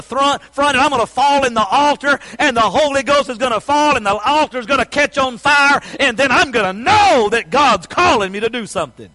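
imagine God dragging you to the altar and shout it; building excitement. Pretend. Imagined dramatic altar call.

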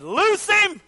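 sudden ringing command; commanding call. Acted. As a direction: call it out as an order. Voices Jesus giving a command.

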